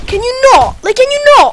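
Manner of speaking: exasperated outburst at the enemy; shrill outrage. Real, not acted. Shocked at attacker.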